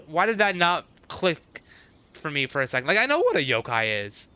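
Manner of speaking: usual speaking voice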